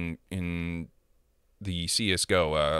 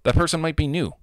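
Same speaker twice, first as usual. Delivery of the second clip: normal talking voice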